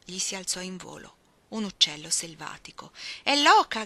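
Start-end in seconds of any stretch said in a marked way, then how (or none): 3.2-3.8 excited cry. Acted. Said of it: "gridò Orlando".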